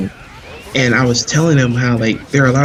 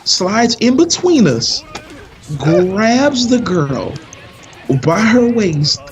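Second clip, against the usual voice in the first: slow and high, milking each phrase; slow storyteller build up to the punchline; dramatic slow build; 7 semitones higher, much slower than usual. A narrator's reading. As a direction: stretch it out for suspense.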